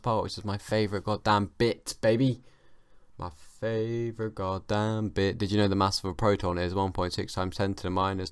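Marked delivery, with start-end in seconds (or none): 3.2-5.4 slow, stretched-out emphasis on each word; drawn-out repeat. Real, not acted. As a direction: linger on every word. Repeats phrase, drawing it out.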